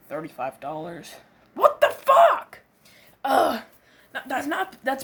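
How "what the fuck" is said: much higher, louder, slow; shocked outburst. Acted. Friend stunned by a cheap flight, high slow loud disbelief. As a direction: blurt out in disbelief.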